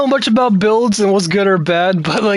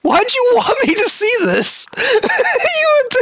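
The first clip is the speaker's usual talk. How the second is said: high laughing disbelief; laughing while talking; slower than usual. Real, not acted. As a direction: laugh through the question. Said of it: "do my villain laugh".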